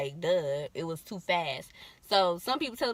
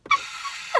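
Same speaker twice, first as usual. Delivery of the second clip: high piercing scream of pain; 4 dB louder than usual. Retold or imitated. In labor on the bed, high pained scream. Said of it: "And I was just like".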